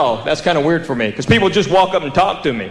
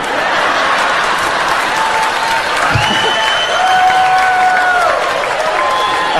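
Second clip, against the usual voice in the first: high wild laugh. Real, not acted. Big laugh at punchline.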